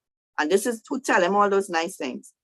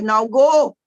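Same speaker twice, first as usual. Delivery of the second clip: loud, higher order as God; shouted command; 4 semitones higher, 8 dB louder than usual. Retold or imitated. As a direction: shout it like God losing patience. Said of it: "then he actually shouted".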